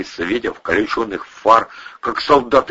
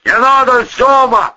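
high, loud cry to stop; pleading shout; 6 dB louder than usual. A narrator's reading. Threatened Volyna begging the boss, shouting. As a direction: cry out to hold him off. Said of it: "крикнул Волына".

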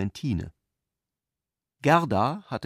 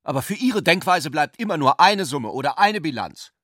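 blurted out, louder and higher; bursting out; 5 semitones higher, 5 dB louder than usual. A narrator's reading. Hans Sepp bursts out at Ulrich, louder and higher. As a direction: explode with pent-up irritation. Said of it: "platzte Hans Sepp heraus".

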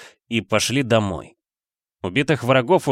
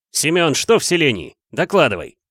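higher-pitched brisk order; commanding; 6 semitones higher, 3 dB louder than usual. A narrator's reading. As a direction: demand a report from a subordinate. Leader demands a report from warrior. (требовательно, по-командирски)

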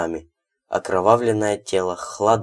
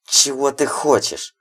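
hissed through teeth; 6 semitones higher than usual. A narrator's reading. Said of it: "прошипел он сквозь зубы".

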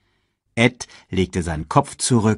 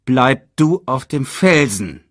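slow, raised, firm order; insistent; 5 semitones higher, 7 dB louder, slower than usual. A narrator's reading. Uncle ordering cub to stay, slow and insistent. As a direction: stretch each word insistently. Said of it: "Scar bestand darauf".

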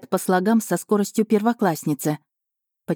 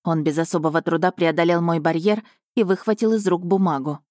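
ordinary delivery

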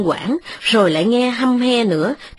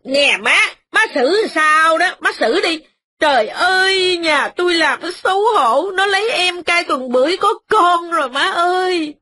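noisy complaining wife; 9 semitones higher than usual. A narrator's reading. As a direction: whine it out, high and indignant. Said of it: "Mợ hai nói ôm sòm rằng".

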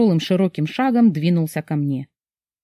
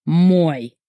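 triumphant breath; 3 semitones lower than usual. A narrator's reading. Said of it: "выдохнул мерзавец победно".

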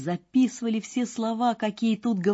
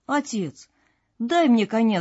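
eager, louder and higher demand; crying out; 5 semitones higher, 5 dB louder than usual. A narrator's reading. Son begging father for a horse, crying out. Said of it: "закричал Ганс Чурбан".

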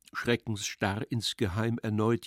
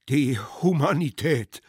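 louder, insistent orator; stubborn resumption; 3 semitones higher, 5 dB louder than usual. A narrator's reading. Speaker resumes after being heckled, stubbornly. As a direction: press on doggedly with the speech. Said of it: "fuhr er schließlich stur fort".

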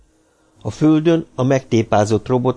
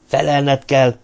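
raised pitch, firm insistence; moderately stern, higher-pitched order; hard interjection; 5 semitones higher, 4 dB louder, slower than usual. A narrator's reading. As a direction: cut in with a firm demand. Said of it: "vágott közbe Daniel keményen".

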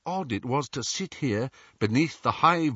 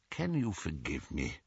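soft, hushed plea for forgiveness; pleading softly; 5 semitones lower, 9 dB quieter than usual. A narrator's reading. Old headmaster begging the boy's forgiveness, hushed. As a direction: ask it quietly, ashamed.

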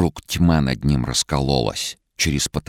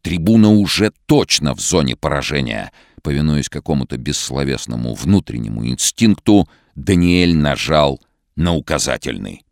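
tense; 3 semitones higher, 7 dB louder than usual. A narrator's reading. Tense climax of story.

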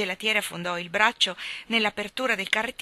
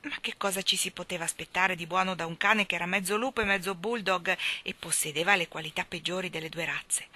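ordinary delivery

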